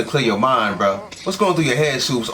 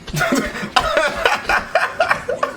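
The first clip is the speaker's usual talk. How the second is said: high, wheezy belly laugh; real burst of laughter. Real, not acted. Viewer sees a character get rocked, bursts out laughing. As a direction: crack up helplessly. Reacting to him getting punched.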